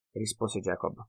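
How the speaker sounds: plain reading voice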